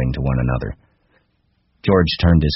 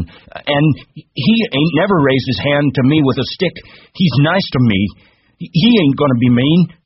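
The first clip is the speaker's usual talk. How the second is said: put-on high, loud childish retort; belligerent; 10 semitones higher, 5 dB louder than usual. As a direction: argue back hotly in a raised pitch. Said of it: "Lenny retorted belligerently".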